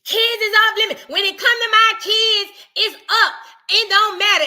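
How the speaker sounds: high, loud indignant mimic; hammed-up protective mother; mock defensive mother. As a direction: bristle like a touchy mom.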